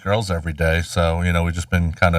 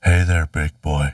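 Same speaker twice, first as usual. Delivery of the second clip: low, slow come-on; husky flirt, drawn out; failed seductive; 5 semitones lower, slower than usual. Pretend. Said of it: "in his best drag voice".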